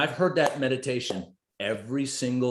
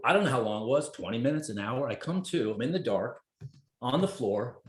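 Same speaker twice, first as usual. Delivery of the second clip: ordinary talk, nothing marked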